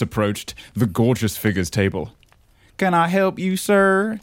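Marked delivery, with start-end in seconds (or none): 2.8-4.2 shy waitress. Acted. Shy waitress voiced in story.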